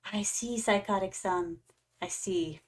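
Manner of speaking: ordinary talk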